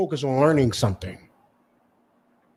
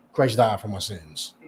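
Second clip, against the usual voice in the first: mock believer; 6 semitones lower, 6 dB quieter than usual. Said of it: "I'm gonna say at the same time".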